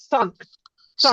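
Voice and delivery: সান্ট said with a clear a vowel; Russian pronunciation. Shown as a demonstration. Teacher modelling Russian সান্ট for students. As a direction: say সান্ট with an open a, not সেন্ট. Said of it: "রাশিয়ানে উচ্চারণ কে".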